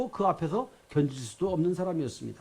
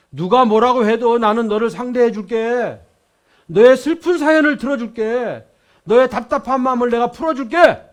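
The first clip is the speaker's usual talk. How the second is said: warm then rising promise; 5 semitones higher, 10 dB louder than usual. Acted. Jesus promises friendship to Zacchaeus, swelling then tender. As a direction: reassure him, swelling, then settle. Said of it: "따뜻한 마음으로, 음성으로".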